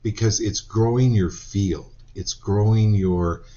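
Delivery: slow, "growing" leaned on and drawn out; slow stress on "growing"; emphasis on growing. Shown as a demonstration. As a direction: press the word "growing" home. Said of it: "stresses growing".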